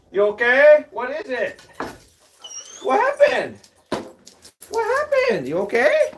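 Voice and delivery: high, anxious calls to a screaming dog; worried calling to dog. Real, not acted. Owner calling to his yelping dog, high and alarmed.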